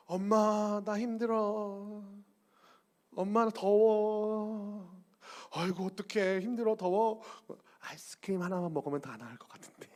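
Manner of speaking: put-on slow, drawn-out whining kid; milk it like a sly child; whiny child. Scheming child whining to mom for ice cream. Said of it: "잔머리 돌리는 친구들은 이래요".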